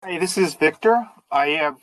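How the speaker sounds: usual speaking voice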